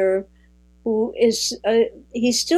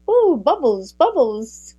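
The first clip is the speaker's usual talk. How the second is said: a light, playful imitation, higher on the Ooh and a little louder; mock bubbly; 5 semitones higher, 3 dB louder than usual. Imitating the south of France speakers, mock bubbly. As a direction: toss off the bubbles like fizzing champagne. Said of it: "they speak as if it's bubbles".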